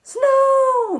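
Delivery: high, loud cry of SNOW; excited exclamation. Shown as a demonstration. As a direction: burst out with joy at the word snow. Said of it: "So you gotta be really excited".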